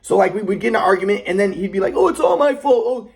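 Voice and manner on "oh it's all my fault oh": high, louder mocking martyr voice; sarcastic grandpa. Grandpa mocking during argument, sarcastic. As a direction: sneer the blame back. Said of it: "putting me down".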